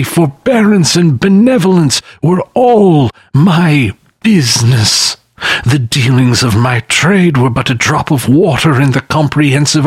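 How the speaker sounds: high, mournful phantom voice; lamenting ghost. A narrator's reading. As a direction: grieve like a chained spirit. Ghost wringing its hands.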